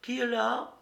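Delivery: high, short questioning call; woman's voice. A narrator's reading. Woman at the window asks who's there, high-voiced. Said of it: "demanda une voix de femme".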